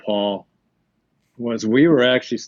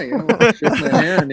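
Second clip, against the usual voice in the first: laughing; 9 semitones higher, 4 dB louder than usual. Real, not acted. Laughing retelling the handshake.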